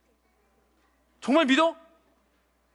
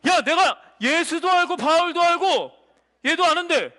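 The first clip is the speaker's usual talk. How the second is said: loud demon voice; 3 semitones higher, 3 dB louder than usual. Acted. Demon calling out one person among believers, high forceful declaration. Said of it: "이런 거예요".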